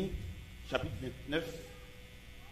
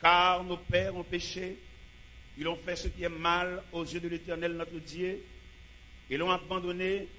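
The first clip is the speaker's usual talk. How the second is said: dramatic Bible reading; 4 semitones higher, 4 dB louder than usual. Acted. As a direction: proclaim King Hezekiah's words with grandeur. (lecture biblique déclamée avec force)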